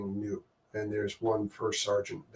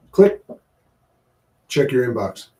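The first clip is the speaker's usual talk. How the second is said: defiant; 2 semitones higher, 10 dB louder than usual. Retold or imitated. Sergeant quitting, defiant.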